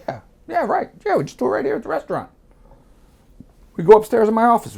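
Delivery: high-pitched, eager mimicry; Sal agreeing. Sal agreeing to do it at the restaurant, eager high imitation.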